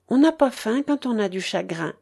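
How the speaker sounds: ordinary delivery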